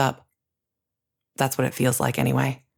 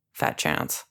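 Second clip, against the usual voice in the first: low unvoiced mutter; 12 semitones lower, 6 dB quieter than usual. A narrator's reading. Captor muttering into the narrator's ear, low scornful mutter. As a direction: mutter it under your breath, scornful. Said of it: "A third voice in my ear mutters".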